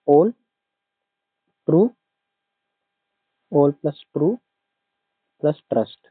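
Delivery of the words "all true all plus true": "all" and "true" set apart with pauses; mnemonic split. Shown as a demonstration. Teacher splitting the word into a memory trick. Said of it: "इसको मैंने कैसे याद किया था".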